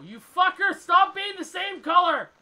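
loud, high-pitched gamer outburst; exasperated yell. Real, not acted. Player yelling at look-alike game characters, exasperated shout. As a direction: yell it full force, dragging out the words.

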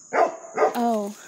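ordinary talk

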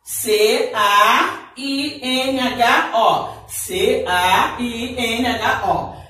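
very slow, letter by letter; spelling out letters. Shown as a demonstration. Teacher spelling a word slowly for the class. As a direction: spell it out for the children one letter at a time. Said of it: "as outras letrinhas são as mesmas".

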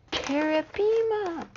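high, slow squeal of delight; high gushing squeal; drawn-out squeal. Real, not acted. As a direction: stretch the name out like a fan. Repeats name with delight.

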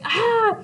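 short high mock cry; exasperated high-pitched "ah"; frustrated cry. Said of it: "So Juliet's like".

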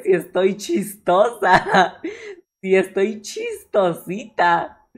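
slow words through laughter; laughing while talking. Real, not acted. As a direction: giggle the words out slowly. Laughing about her own edits.

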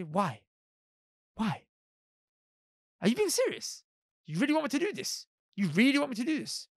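high, hushed disbelief on the questions; mock incredulity, pitch jumping up; incredulous questioning. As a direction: question it as if you can't believe the order. Acts out people questioning a command.

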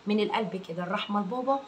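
normal talking voice

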